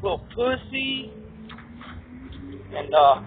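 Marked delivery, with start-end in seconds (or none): 0.0-1.1 higher, slow drawn-out jeer; taunting. Retold or imitated. Jeering at his father who drove away, slow taunt. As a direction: drag it out to mock him.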